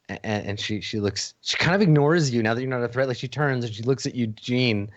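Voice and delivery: ordinary delivery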